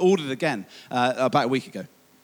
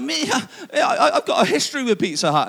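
sheepish laugh; 6 semitones higher, 6 dB louder than usual. Retold or imitated. Answering wife, laughing embarrassed.

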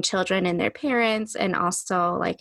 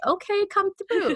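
much higher, sassy encouraging call; sassy cheer; 11 semitones higher than usual. Retold or imitated. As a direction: cheer your inner self on. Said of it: "I haven't heard her in a very long time".

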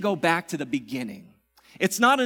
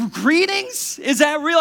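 much higher, loud disbelieving question; incredulous; 9 semitones higher, 8 dB louder, slower than usual. Pretend. Pastor joking to church about the greeting, loud disbelief. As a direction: ask it in mock disbelief. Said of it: "it's like".

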